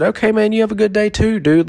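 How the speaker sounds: high, bright fake-friendly reply; sarcastic sing-song goodbye; mock chipper reply. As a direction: parrot it back overly cheerful. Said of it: "and I said".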